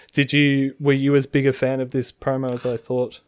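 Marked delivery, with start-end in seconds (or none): none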